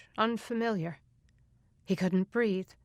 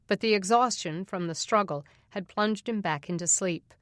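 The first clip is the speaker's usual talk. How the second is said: ordinary delivery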